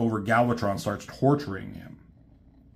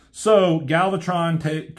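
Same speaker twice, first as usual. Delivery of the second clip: normal talking voice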